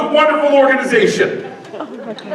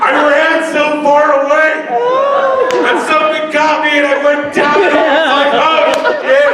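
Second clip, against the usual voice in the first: panicked shouting; 6 semitones higher than usual. Pretend. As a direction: yell it out breathless. Mock panicked retelling, loud.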